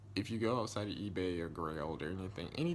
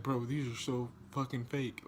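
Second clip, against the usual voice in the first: mock outburst, pitched a little higher; cursing buyer; 4 semitones higher, 3 dB louder than usual. Buyer accusing seller of sending fake shoes, cursing. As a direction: imitate someone starting to curse. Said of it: "like, cursing".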